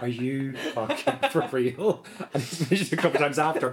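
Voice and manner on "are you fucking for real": incredulous. Retold or imitated. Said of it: "he looked at me like".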